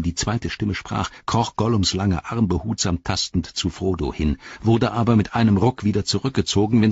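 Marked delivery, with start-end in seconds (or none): none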